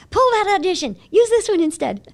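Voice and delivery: high, loud urgent demand. Retold or imitated. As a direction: rush to stop the mistake. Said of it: "I'm like".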